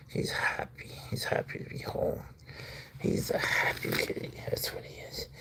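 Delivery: soft unvoiced whisper. Real, not acted. Owner whispers praise to the happy cat. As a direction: whisper it tenderly.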